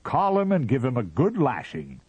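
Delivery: high, loud outburst; cried out. A narrator's reading. Mister Ah Ah cries out in glee, high. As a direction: cry it out with relish. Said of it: "cried Mister Ah Ah".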